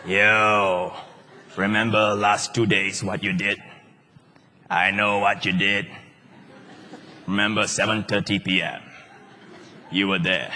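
low, soft insinuating devil voice; sly accusing devil. Pretend. Devil reminding the believer of past sin, low sly voice. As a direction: creep up low and sly, fishing for guilt. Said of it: "Let me try again".

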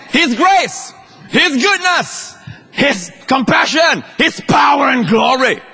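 high, emphatic preaching; fervent exhortation. Acted. Preacher urging church to stop looking at faith, fervent exhortation. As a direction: drive each phrase home with ringing conviction.

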